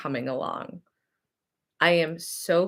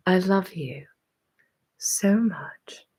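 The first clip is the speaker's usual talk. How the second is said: whispered sign-off. Real, not acted. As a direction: breathe the goodbye close to the mic.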